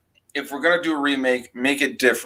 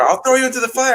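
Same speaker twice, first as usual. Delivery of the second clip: much higher, hammed-up threatening mother; mock threat; 12 semitones higher, faster than usual. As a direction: threaten the doll, high and hammed. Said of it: "And he's, or".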